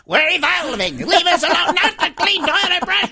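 high squeaky germ crying for help; tiny germ pleading, high and frantic; cute germ voice. Acted. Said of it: "was that your impression of a germ in your toilet".

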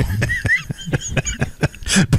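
high, wheezy burst of laughter; real laughter. Real, not acted. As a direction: crack up at the joke. Laughing at the prison joke.

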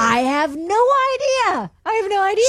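high, helpless exclamation; real bafflement. Real, not acted. Room mom asked about the June birthday, utterly baffled. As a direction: throw it out high-pitched and at a loss. Baffled by own false memory.

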